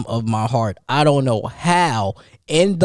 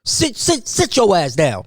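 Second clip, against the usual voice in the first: stepdaddy voice; 7 semitones higher than usual. Retold or imitated. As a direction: yell like a strict stepdad getting the kids in line. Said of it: "That's my best stepdaddy voice".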